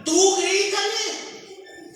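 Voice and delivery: high, slow egging-on voice; mock friend's advice. Friends telling the wife to yell back at her husband, mock high and slow. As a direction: ham up a gossiping friend urging her on. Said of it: "¿qué dicen las amigas".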